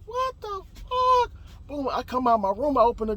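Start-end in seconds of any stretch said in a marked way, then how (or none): 0.1-1.3 high, drawn-out, stunned reaction; bewildered disbelief. Retold or imitated. Man woken by yelling reacts, bewildered disbelief.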